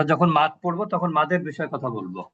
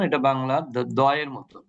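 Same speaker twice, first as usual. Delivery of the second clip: ordinary talk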